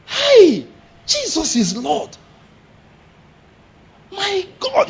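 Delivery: very high, stretched-out startled cries; shocked exclamation. Shown as a demonstration. Preacher shows what he says for everything, acted shock. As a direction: act stunned, voice shooting up. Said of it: "that's one of the ways I express my shock".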